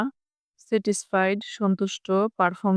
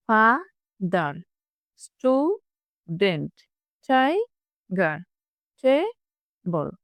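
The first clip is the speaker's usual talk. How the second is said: Father, student, tiger, table each slowly split in two, with a gap; syllable-by-syllable model; much slower than usual. Shown as a demonstration. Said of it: "এভাবে তোমরা হচ্ছে repeat করবে". (Father, student, tiger, table দুই ভাগে ভেঙে ধীরে)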